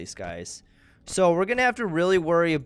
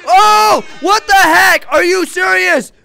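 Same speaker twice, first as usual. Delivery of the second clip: high loud panicked shouting; scared yelling; 13 semitones higher, 13 dB louder than usual. Real, not acted. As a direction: scream in fright at Golden Freddy. Jump scares in horror game.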